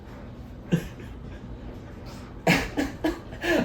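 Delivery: long high giggling fit; helpless laughter. Retold or imitated. Worn out after the flight, she cracks up in a high giggling fit. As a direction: laugh until you can't stop. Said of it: "I couldn't stop laughing".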